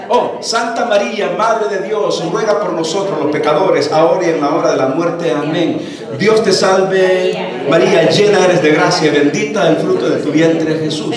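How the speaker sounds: mocking the repetitive rosary prayer; mock rote prayer recital.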